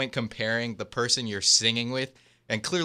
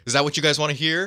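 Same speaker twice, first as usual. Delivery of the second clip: quick, higher-pitched quoted question; retold challenge; 6 semitones higher, much faster than usual. Troy asking his friends what they want, retold pointedly. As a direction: toss it out fast as a pointed question.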